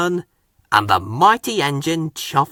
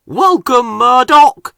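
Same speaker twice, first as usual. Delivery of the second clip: loud, much higher welcoming call; 10 semitones higher, 8 dB louder than usual. Acted. Harvey calling a welcome to the new engine, loud and high. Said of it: "called Harvey".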